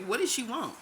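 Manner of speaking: amused repeat of What does she want?; laughing. Real, not acted.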